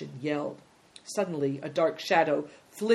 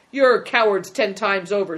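loud, higher, angry yell; screamed insult; 4 semitones higher, 10 dB louder than usual. Acted. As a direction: hurl it at your captors. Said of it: "screamed the boy".

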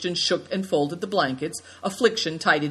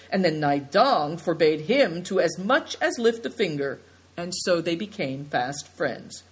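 usual speaking voice

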